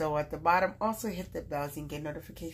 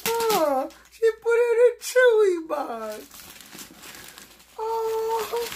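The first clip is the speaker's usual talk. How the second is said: much higher and louder, squealing with delight; excited squeal; 8 dB louder, slower than usual. Real, not acted. Opening a friend's gift box and seeing the packaging, delighted squeal. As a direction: shriek with joy at the surprise.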